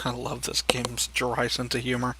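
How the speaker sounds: normal talking voice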